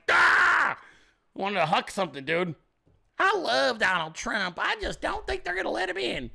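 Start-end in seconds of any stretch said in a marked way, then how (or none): 0.1-0.8 loud, high cry of exasperation; acted-out outburst, shouted; frustrated yell. Host reliving the urge to throw something, frustrated yell. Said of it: "I felt like". 3.2-6.3 mocked lady voter. Host mocking the DeSantis supporter, hammed-up lady voice. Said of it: "How about the one fucking lady?".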